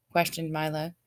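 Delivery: ordinary delivery, nothing marked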